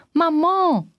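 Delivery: high, drawn-out call for mother; long, high calling shout, held back; contained yell. A narrator's reading. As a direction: cry out for mother without full volume. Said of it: "brüllte ich".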